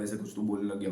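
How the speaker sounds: ordinary delivery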